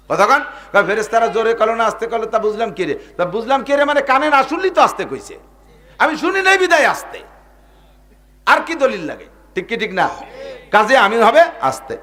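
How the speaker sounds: high, loud, impassioned sermon voice; fiery preaching. Acted. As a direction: thunder it out from the pulpit. Preaching at full force. (জোরালো ওয়াজের সুর)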